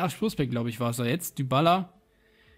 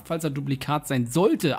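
ordinary talk

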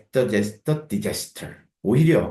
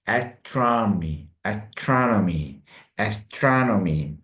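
astronomy repeated very slowly; slow review drill; much slower than usual. Shown as a demonstration. Teacher slowly drilling astronomy in review. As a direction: say astronomy slowly three times. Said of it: "오늘은 다섯 단어를 해봤습니다".

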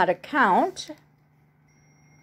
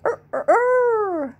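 rooster crow; 13 semitones higher than usual. Shown as a demonstration. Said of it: "is what a rooster says".

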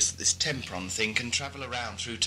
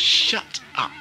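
higher, louder, cutting him off; snapping at Ockerby to shut up; angry snap; 7 dB louder than usual. Acted. Stride snaps at Ockerby.

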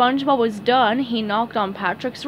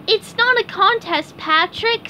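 high-pitched cartoon reasoning; SpongeBob; 11 semitones higher, 4 dB louder than usual. A narrator's reading. SpongeBob reasons with his competitive friend, high cartoon voice. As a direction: gently set him straight, pitched high. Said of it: "said SpongeBob".

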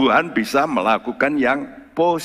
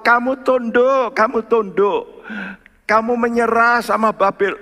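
prophet crying out; 11 semitones higher, 4 dB louder than usual. Retold or imitated. Jeremiah warns Israel to surrender, high and loud. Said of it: "Nabi Yeremia sudah teriak-teriak".

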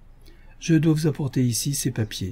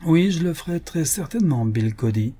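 "Oui" hit higher, each word weighted; every word stressed; 5 semitones higher than usual. A narrator's reading. General threatening Bill, every word stressed. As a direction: lean on every word, menacing. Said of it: "Il accentuait chaque mot d'une manière significative".

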